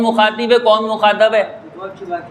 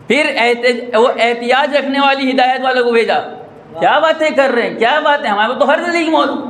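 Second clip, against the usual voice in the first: higher, with mocking laughter; fiery preaching; 5 semitones higher than usual. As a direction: preach it hard, half laughing. Preaching at full force. (جوشیلی خطابت، طنزیہ ہنسی کے ساتھ)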